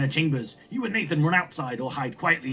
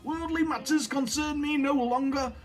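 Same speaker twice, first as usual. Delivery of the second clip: mad grandfather, tormented; 12 semitones higher than usual. Acted. Grandfather sends grandchild away, high and tormented=.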